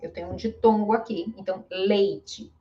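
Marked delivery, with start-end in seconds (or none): 1.7-2.5 "leite" as LAY-chee; E then final I. Shown as a demonstration. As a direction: say "leite" with first E kept, last E as ee. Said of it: "Este ficou com som de I".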